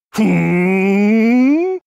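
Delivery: long hum swelling and rising; pondering hum. Acted.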